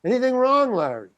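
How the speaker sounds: high-pitched taunting question, dropping on the name; needling director. Retold or imitated. Director goading his struggling actor, needling. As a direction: imitate a director enjoying the torment. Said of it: "he's getting off on it".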